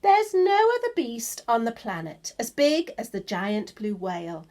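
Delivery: plain reading voice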